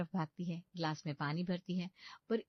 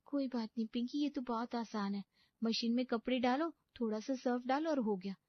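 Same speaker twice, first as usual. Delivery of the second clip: lightly voiced young bride, higher, cheering herself on; a nervous bride talking herself into it; pep talk to herself; 6 semitones higher than usual. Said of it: "Pinky खुद से कहती है".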